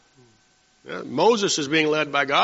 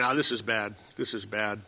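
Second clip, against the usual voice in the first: soft, much lower fretful mutter; grumbling murmur; 7 semitones lower, 9 dB quieter than usual. Retold or imitated. The people murmuring about trouble, soft low mutter. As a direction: mutter it to yourself in dismay. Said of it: "They're saying".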